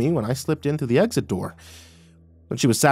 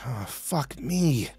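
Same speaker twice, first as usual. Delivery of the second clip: long, drawn-out soft groan; 7 dB quieter, much slower than usual. A narrator's reading. Narrator on seeing the blood, groaning. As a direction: groan it slowly in dismay. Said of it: "I groaned".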